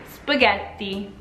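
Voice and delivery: spaghetti with a pause on the T; slow spaghetti, held tt; Italian spaghetti. Shown as a demonstration. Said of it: "a pause on the T".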